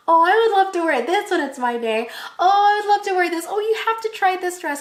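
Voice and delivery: high, dreamy swooning over a dress; gushing sister. Retold or imitated. Sister browsing wedding dresses for herself, imitated high-pitched gushing.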